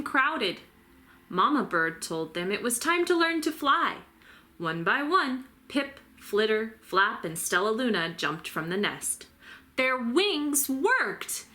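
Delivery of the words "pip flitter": ordinary delivery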